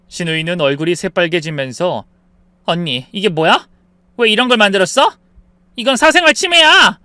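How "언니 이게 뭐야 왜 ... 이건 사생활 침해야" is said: building from protest to high, loud yell; acted outraged sister-in-law; indignant shouting. A narrator's reading. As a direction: accuse her, louder with each sentence. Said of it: "소리쳤죠".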